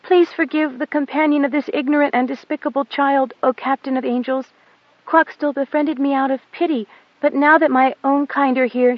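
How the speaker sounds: put-on high, humble little girl begging forgiveness; meek pleading girl. A narrator's reading. Goat Dung begs the pilot's pardon in a high meek voice. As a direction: grovel before the captain of angels.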